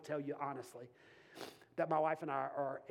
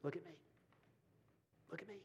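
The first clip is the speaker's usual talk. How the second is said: gentle voice of the Spirit; 3 semitones lower, 13 dB quieter, much faster than usual. Retold or imitated. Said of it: "heard the voice of the Spirit say".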